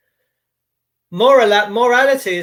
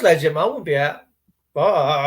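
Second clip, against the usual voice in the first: normal talking voice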